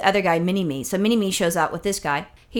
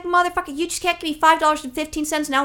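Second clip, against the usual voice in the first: higher-pitched exasperated rant; retold outburst; 6 semitones higher than usual. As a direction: cut in over others, indignant and high. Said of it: "I'm talking over people".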